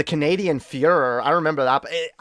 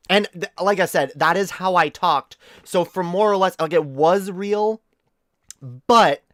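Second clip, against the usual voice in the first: ordinary delivery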